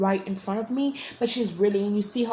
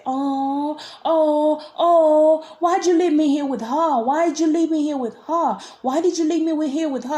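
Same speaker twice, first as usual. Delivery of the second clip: imitated brother wailing; 7 semitones higher, 5 dB louder than usual. Imitating brother asking why he was left, high plaintive voice. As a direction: plead in a raised, insistent voice. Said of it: "I was hearing him say".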